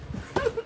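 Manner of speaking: shrill burst of real laughter; high laugh. Real, not acted. Laughing at a viewer's comment in chat. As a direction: laugh up high, unable to hold it.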